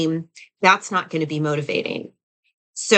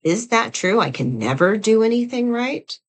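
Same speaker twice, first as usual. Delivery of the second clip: pitched higher, doubting the thought; raised, disbelieving "Is that true?"; incredulous self-questioning; 5 semitones higher than usual. Shown as a demonstration. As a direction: challenge it in disbelief. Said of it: "And you ask yourself".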